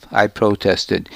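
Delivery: ordinary delivery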